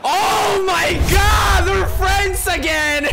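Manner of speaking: high, slow, ecstatic scream; excited screaming. Real, not acted. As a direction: scream it out in disbelief. Gamer screaming at a goal.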